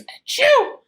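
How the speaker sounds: very high, loud little monster calling; little monster calling his mom. Acted. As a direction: sneeze then call out shrilly. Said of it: "he called".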